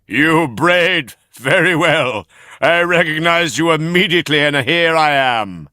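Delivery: high, loud sneering coachman voice; gloating coachman. Acted. Coachman mocking the donkeys, high and loud. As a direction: gloat over the caught boys.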